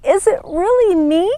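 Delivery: amazed at her new blossoms; exclaimed. Acted. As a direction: burst out in joyful disbelief. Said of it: "she exclaimed to Sister Rainbow".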